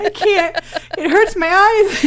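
high, loud and breaking with laughter; helpless laughing wail. Real, not acted. Co-host overwhelmed by the sight of the tights, helpless laughing wail. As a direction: wail it through real laughter, drawing it out.

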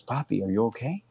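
put-on soft, hushed little kid's voice; a worried child peeking in at night; sleepy child asking. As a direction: son checking on his dad at night, softly voiced. Son finds dad awake at 3am.

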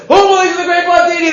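high, fast carnival barker cry; showman's call. Retold or imitated. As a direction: rouse the crowd like a circus showman. Showman calling to the crowd.

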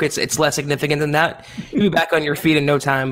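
ordinary talk, nothing marked

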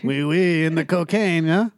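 put-on a light French accent as a beach hawker; hawking jet skis in a hint of French. Beach vendor pitching tourists, lightly French accented. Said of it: "the French guy in the Mexican beach impression".

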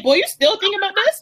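high, disbelieving question; incredulous. Retold or imitated. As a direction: ask it in total disbelief. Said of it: "I'm like".

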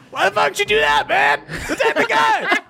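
high yelling goon; Petty Jack voice. Acted. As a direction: shout it high, laughing. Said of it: "And Petty Jack goes".

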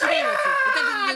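screeching, drawn-out scary teacher yell; 北村先生. Acted. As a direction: yell it very high and slow, as a raging gym teacher. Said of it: "梶原一騎さんによく似てる怖い体育の先生". (怖い体育教師の甲高い絶叫)

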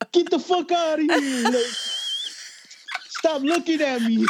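mock furious yell, pitched high; retold angry outburst. To his parents in therapy, a hammed-up angry yell. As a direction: replay the teenage rage at the watching parents, half laughing. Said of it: "super pissed off and fucking mad".

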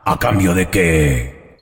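very low, slow rasping demon; raspy devil. Acted. Devil appears to whoever summoned him, deep raspy voice. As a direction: growl it slowly from the depths. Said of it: "Qué voz tan rasposa".

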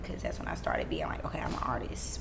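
low hushed whisper. Retold or imitated. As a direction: say it under your breath, secretive. Whispered aside about becoming artist.

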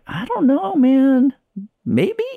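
high, unsure shrug of a voice; doubtful high voice. Retold or imitated. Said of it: "I'd be like".

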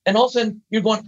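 ordinary talk, nothing marked

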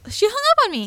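high, incredulous complaint; indignant disbelief. Real, not acted. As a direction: voice leaps up in outrage. Indignant at being hung up on.

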